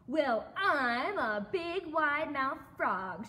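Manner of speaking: slow, loud boast with swooping pitch; big-mouthed frog announcing himself loudly; shouted. A narrator's reading. Frog introduces himself to another animal, shouted. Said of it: "shouted the wide-mouthed frog".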